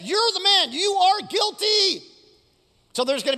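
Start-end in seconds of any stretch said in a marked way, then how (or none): 0.0-0.7 high, ringing accusation; forceful accusation. Acted. Nathan confronts King David, loud and accusing. 0.7-2.0 high, slow, ringing condemnation; forceful verdict. Acted. Nathan pronounces David guilty, high and forceful.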